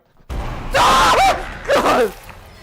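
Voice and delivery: loud sudden fright shriek; startled scream. Real, not acted. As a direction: scream as the scare hits. Jump scare in horror game.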